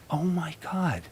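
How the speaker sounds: soft unvoiced whisper of shock; whispered realization. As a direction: breathe it out like a sudden inner discovery. Stunned private realization.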